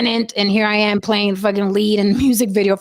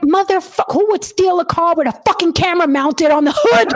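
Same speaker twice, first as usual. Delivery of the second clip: retold angry retort; 7 semitones higher than usual. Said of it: "I was so fucking pissed".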